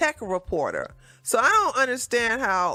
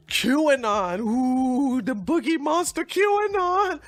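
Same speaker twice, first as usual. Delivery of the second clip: mock spooky; 4 semitones higher, slower than usual. Mocking fear of QAnon.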